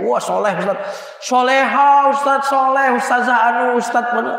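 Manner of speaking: mock admiring. Imitating mothers admiring a preacher, gushing. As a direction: gush like an adoring fan.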